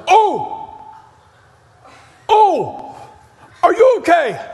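high, alarmed cries of concern; startled passerby. Retold or imitated. Passerby startled at the bleeding preacher, high alarmed calls. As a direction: play a young man shocked to see someone bleeding. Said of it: "the guy goes".

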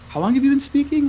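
can't believe what he hears; disbelieving question. Retold or imitated. Professor grills his student, high and incredulous.

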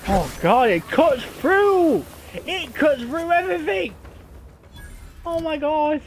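very high, loud amazement; real excited shriek. Real, not acted. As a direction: squeal in awe at your new weapon. Amazed by the new plasma cutter.

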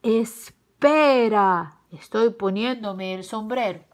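loud drawn-out first word, then easing; stalling wolf. A narrator's reading. Tío Lobo interrupting from inside, drawn-out wolf voice. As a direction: stretch out a loud 'wait', then settle.

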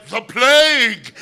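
slow, high, loud plague cry; slow stunned proclamation. Retold or imitated. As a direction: cry the scripted line, sticking to the script. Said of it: "Miles went".

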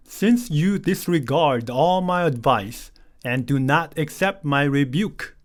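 slow, careful reading of the verse; slow English Bible reading for learners. Shown as a demonstration. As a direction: read each word out slowly for the class. Teacher reads the verse slowly.

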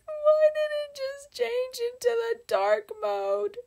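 high, loud put-on wail; mock crying. Streamer to viewers as the game changes to dark mode, mock crying. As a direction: wail it like a hammed-up sob. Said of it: "I'm just gonna start crying".

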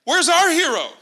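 slow, eager call to the army; David calling out for a hero; eager challenge. Retold or imitated. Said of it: "And David's like".

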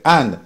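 and said for hand, no H; dropped H. Shown as a demonstration. Teacher demonstrating Jamaican patois, dropping the H. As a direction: drop the H of hand so it is and. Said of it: "en lugar de decir hand".

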